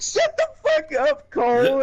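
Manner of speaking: hammed-up exasperated yell; mock angry outburst. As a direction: imitate a sore loser lashing out. Retold opponent snapping back at teller.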